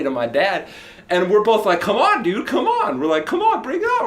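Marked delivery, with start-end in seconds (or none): 1.8-4.1 high-pitched taunting call; acted-out goading, pitched way up; retold challenge. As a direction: egg him on, high and excited. Both daring the man to fight.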